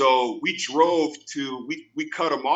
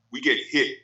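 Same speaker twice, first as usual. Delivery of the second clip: usual speaking voice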